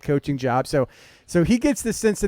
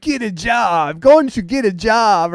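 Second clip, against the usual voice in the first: stretched-out, raised heckling comeback; drawn-out, louder mock holler; mock retort yell; 4 semitones higher, 6 dB louder, slower than usual. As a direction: imitate a coach hollering back at hecklers. Acting out coach's retort to hecklers.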